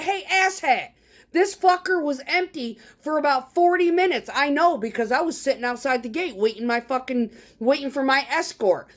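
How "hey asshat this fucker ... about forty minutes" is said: higher, half-shouted retold yell; calling out the window at the skid steer driver. Driver yelling out the truck window at the skid steer guy, half-shouted. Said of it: "I yell out the window".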